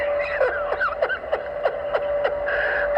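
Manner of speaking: high, airy wheeze of a laugh; wheezing laugh. Real, not acted. Cracking up at the controller's bomb drop, wheezing high. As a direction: break into a silent high wheeze.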